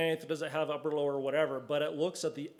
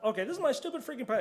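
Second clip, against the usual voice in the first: rushed, higher, fed-up ranting; exasperated rush; 8 semitones higher, much faster than usual. Retold or imitated. Meme guy retyping a rejected password, exasperated rush. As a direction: blurt it out faster and faster in annoyance.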